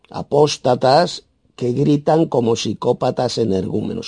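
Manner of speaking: ordinary talk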